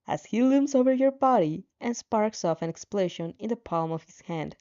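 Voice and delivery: plain reading voice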